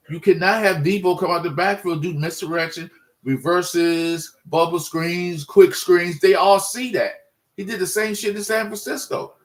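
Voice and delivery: heated rant. Real, not acted. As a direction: fire off each play in disgust.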